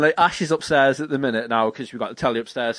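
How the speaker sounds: ordinary talk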